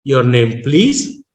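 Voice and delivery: "your name" low and level, "please" jumps up; flat, then rising. Shown as a demonstration. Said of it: "two words are in a flat tone".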